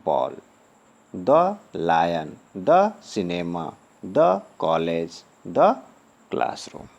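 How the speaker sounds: each "द" phrase spoken slowly with a short "द"; slow drill. Shown as a demonstration. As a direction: read the list like a teacher drilling the class. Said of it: "एक बार और देख लेते हैं इसका उच्चारण". ("द" वाले शब्द धीरे-धीरे बोलिए)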